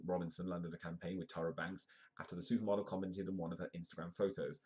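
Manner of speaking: ordinary delivery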